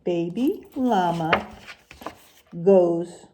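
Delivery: sing-song rhyming picture-book read, unhurried; reading to children. Acted. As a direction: read the rhymes to the class with a lilt. Teacher reads picture book aloud.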